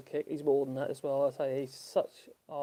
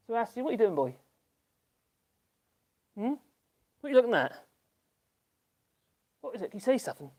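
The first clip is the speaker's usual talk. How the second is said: talking to the dog; 7 semitones higher, faster than usual. Real, not acted.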